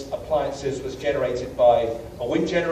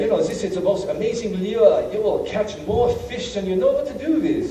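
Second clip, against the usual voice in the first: higher-pitched, hammed-up German accent; terrible German accent; 7 semitones higher than usual. U-boat commander praising his lure, terrible German accent. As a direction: imitate a German with a badly done accent. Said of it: "That's my German accent, really terrible".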